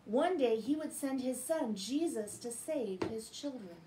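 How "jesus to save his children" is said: ordinary delivery, nothing marked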